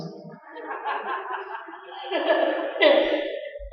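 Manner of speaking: a long airy laugh at his own joke; breathy laugh. Real, not acted. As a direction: let a silent, breathy laugh roll out. Laughs at own funny list.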